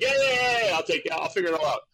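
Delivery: high, fast, dismissive yeahs; a carefree kid brushing off his dad, high and quick; breezy brush-off. Retold or imitated. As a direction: wave off the lecture breezily. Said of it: "I'm like".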